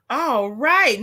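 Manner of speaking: ordinary talk, nothing marked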